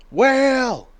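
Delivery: high, loud lookout cry; shouting from crow's nest. Acted. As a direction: call it out from the mast.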